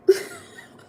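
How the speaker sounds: sudden high-pitched squeal of laughter. Real, not acted. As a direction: burst into a loud squealing laugh. Laughing at her own story.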